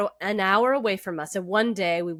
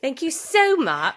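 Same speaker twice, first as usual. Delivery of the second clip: put-on British accent, slightly raised pitch; 4 semitones higher, 3 dB louder than usual. Host reading winner's thanks on co-host's request, bad British accent. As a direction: gush thanks in amateur British. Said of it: "you have to do the British accent".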